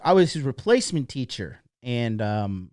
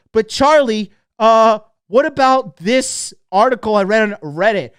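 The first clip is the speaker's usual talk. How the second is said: higher, loud, pestering objection; mock interrupting pupil; 6 semitones higher, 8 dB louder than usual. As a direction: butt in like an annoying know-it-all student. Mimics a pupil interrupting Charlie.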